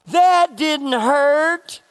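put-on high, slow, loud child's shout; yelling kid. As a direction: shout it across the house like a defiant little boy. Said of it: "my son yells out".

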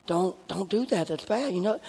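softly and gently, no fuss; low-key scolding. Retold or imitated. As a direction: keep the scolding hushed so no one hears. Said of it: "play this as low-key as I can".

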